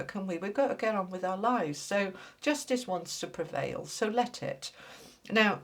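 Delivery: ordinary talk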